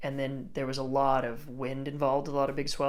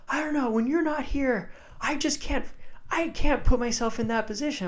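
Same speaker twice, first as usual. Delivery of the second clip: high, raised, pleading voice; distressed protest; 10 semitones higher, 5 dB louder than usual. Retold or imitated. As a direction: imitate a friend calling out that it's too much. Imitates friend protesting he cannot.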